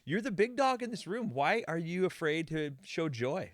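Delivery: high, loud mock exasperation; incredulous plea. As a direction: plead with them in disbelief, stretching it out. Acted plea to a stiff boss.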